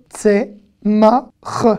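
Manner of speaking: "Tsemach" broken into tse, ma, kh; slow "Tsemach", gaps before each sound; syllable by syllable. Shown as a demonstration. Teacher sounding out "Tsemach" for learners, syllable by syllable.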